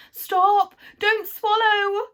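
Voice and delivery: very high, loud, slow yell of alarm; panicked yell. Acted. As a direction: shriek the warning in panic. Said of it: "yelled Moonface".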